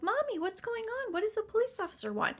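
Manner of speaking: high-pitched imitation of worried children; young kids. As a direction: pipe up like a child asking Mommy. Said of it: "And the kids are like".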